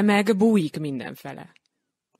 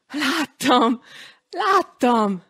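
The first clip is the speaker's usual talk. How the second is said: put-on high, louder child's cry of delight; the boy shouts to grandma that he saw it; excited yell; 7 semitones higher, 5 dB louder, much slower than usual. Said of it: "ordította". (izgatott gyerekkiáltás)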